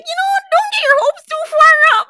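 very high, loud little voice; mimicked friend's warning. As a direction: caution in a squeaky imitation.

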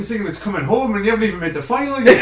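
high, quick mock rant; mock exasperation. Imitating the pundit scolding his laughing colleagues, mock exasperated. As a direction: protest loudly through laughter. Retelling Keane mocking premature celebration.

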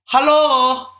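high, drawn-out camp German greeting; camp German accent. Acted. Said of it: "It's camp, innit?".

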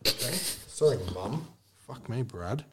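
ordinary delivery

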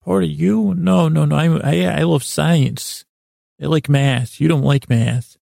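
lightly acted little kid voice; little boy. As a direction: play a small boy, taken aback. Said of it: "a little kid part of me".

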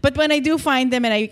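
ordinary talk, nothing marked

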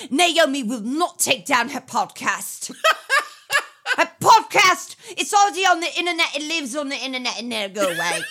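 a cockney defender of her podcast, through laughter; mock cockney. Husband imagined defending Naomi's podcast, laughing cockney voice. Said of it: "It was way more, like, cockney".

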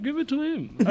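high, put-on French accent, lightly laughing; French footballer impression. Retelling the agent's advice in a French accent. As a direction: mimic the French player's accent, high and giggly. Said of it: "You're Jim Bonder impression".

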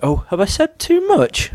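high, slow fake innocent; high mock innocence.

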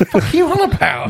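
high, laughing mock outrage; incredulous laughing retort; laughing disbelief. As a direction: squeal it through a laugh. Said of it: "And you're like".

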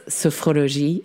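"sophrologie" said the French way; French accent. Retold or imitated. Host quoting her guest's name for the method, French accent. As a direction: imitate a French pronunciation of "sophrologie". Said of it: "so much nicer in a French accent".

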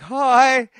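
high, stretched-out hello; mock-meek, lifted pitch; wary greeting imitated. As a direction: answer a feared stranger over-politely. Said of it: "And we're like".

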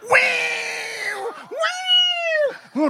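very high, drawn-out guitar wail; wailing guitar imitation. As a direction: vocalise a screaming guitar bend. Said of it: "your man was like going".

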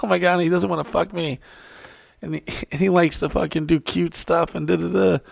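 high, girlish mimicry; imitated gushing girl. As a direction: prattle adoringly in a high girl's voice.

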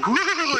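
playful high, drawn-out "woo"; mock sci-fi travel whoosh; time-machine whoosh. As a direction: swoop up like a time machine taking off. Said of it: "it's a time machine".

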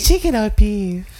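pushy server calling out the choice; dim sum cart server. Pretend. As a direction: call it out like a cart lady. Repeats the dim sum server role.

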